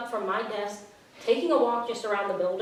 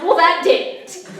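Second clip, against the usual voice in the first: higher, louder and slow, a pointed retort; retold annoyance; 9 semitones higher, 12 dB louder, slower than usual. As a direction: drag out a fed-up "Well". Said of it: "And I got mad".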